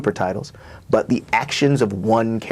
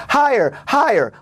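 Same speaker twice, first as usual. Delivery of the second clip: high, called-out "Higher, higher!"; hollered; 14 semitones higher, 4 dB louder than usual. A narrator's reading. Fathers on the playground hollering to kids, high shout. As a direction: call it up like a father at the swings. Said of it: "Our fathers holler".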